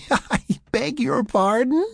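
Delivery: very high, drawn-out giggly question; giggling. A narrator's reading. Sarah falling against Mac, giggling mock offence. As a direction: burst into a giggle, then squeal it slowly. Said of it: "she giggled again, a bit louder".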